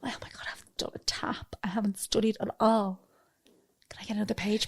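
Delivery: imitating a fake-modest swot in a whisper; hushed, unvoiced fake panic; whispered mock humblebrag from a swot. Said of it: "these people are like".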